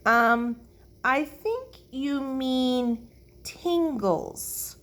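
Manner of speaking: high, slow, halting; hesitant correction. A narrator's reading. Herbert corrects Lucille's word, hesitantly. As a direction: correct her gingerly, drawing out the words.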